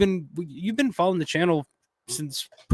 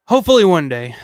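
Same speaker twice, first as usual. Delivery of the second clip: hopeful; 3 semitones higher, 9 dB louder than usual. Real, not acted. Hopes to quit smoking.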